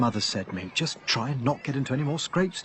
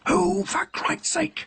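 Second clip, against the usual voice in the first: exasperated whisper; 4 dB louder, much slower than usual. Acted. Exasperated husband in hiding.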